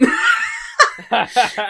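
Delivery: high squealing laugh; high cackle. Real, not acted. Own story laughter.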